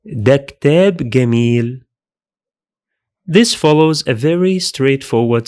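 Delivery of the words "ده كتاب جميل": ده كتاب جميل spoken fast in one smooth run; the whole phrase said at normal conversational speed; natural flowing speed. Shown as a demonstration. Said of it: "would be like this".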